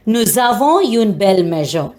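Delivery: ordinary delivery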